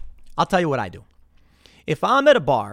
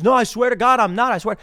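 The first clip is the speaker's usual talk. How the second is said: higher, louder begging; mock pleading denial; 6 semitones higher, 5 dB louder than usual. The accused bartender begging patrons, mock pleading. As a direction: plead your innocence. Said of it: "beg and cry".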